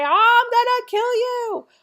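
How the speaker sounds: high, loud exasperated threat; mock angry parent. Exasperated parent to persistent kids, high loud mock threat. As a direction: ham up the empty threat.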